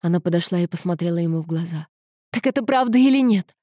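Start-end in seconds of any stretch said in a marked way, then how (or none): 2.3-3.5 higher-pitched, agitated question; upset, impatient confrontation; insistent demand. A narrator's reading. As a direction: press hard for the truth. Insists on an answer.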